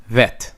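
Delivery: Vet ending on a hard t, no th. Shown as a demonstration. Teacher giving the modern way to say Vet. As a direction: clip the end of Vet to a plain t. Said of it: "people pronounce it now".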